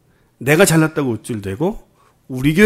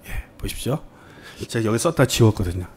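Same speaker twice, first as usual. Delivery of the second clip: unvoiced whisper of 예, 보십시오, half to himself; hushed sheepish aside; 3 semitones lower, 6 dB quieter than usual. Real, not acted. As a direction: breathe 보십시오 as a private aside with a lift at the end. Aside about an erased slide note.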